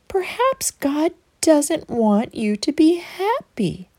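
slow, sly serpent voice; snake voice. Acted. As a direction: coax her slowly like a tempting snake. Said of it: "the serpent whispered".

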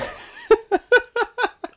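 high-pitched helpless laugh. Real, not acted. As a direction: break into a squealing laugh. Laughing at the awful taste.

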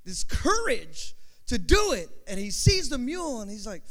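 ordinary talk, nothing marked

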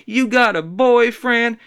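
high, loud, slow catcall voice. Retold or imitated. Guy catcalling a lady in the stairwell, high and slow. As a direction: slide in with a slow loud pickup line. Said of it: "I said".

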